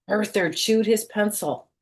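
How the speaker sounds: ordinary delivery, nothing marked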